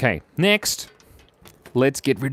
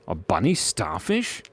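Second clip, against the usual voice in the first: "A bunny starfish?" asked softer with a rising, puzzled tune; puzzled question; 6 semitones lower, 7 dB quieter than usual. Real, not acted. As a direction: repeat it as if doubting the idea.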